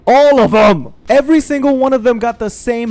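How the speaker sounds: loud, high, drawn-out emphasis; emphatic rant. Real, not acted. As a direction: hammer it out slowly and loudly. Ranting about identical manipulators.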